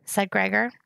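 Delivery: ordinary delivery, nothing marked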